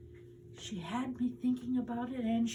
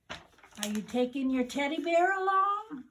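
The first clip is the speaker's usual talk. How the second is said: teasing big sister; 7 semitones higher, 7 dB louder than usual. Acted. Big sister teasing her brother.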